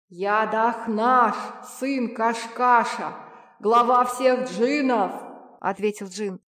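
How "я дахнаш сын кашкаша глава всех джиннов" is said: slow, loud, booming genie; booming thunder voice. A narrator's reading. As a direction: proclaim it like rolling thunder. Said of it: "голосом, подобным грому".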